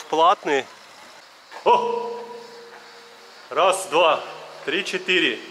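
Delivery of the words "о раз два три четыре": counting aloud into a tunnel echo; cathedral echo. Real, not acted. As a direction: voice ringing back off tunnel walls. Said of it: "О, какое эхо".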